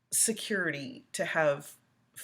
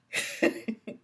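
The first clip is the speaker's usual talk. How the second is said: burst of laughter; 6 semitones higher, 3 dB louder than usual. Real, not acted. Laughs reading viewer comment.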